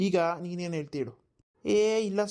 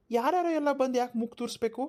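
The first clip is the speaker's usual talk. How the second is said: high, sharp protest at meddling; indignant outburst; 6 semitones higher than usual. Acted. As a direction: throw it back at the crowd, pitched up. Husband rebuffs village meddlers.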